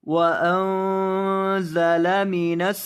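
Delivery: slow, drawn-out tajweed chanting; Quran recitation. Shown as a demonstration. Teacher reciting the verse for students, slow chant. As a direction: recite the verse slowly with long held vowels.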